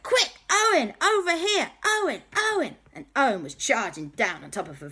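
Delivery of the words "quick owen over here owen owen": high, urgent calling out; Andy. Acted. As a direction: call a friend over frantically. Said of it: "Andy was like".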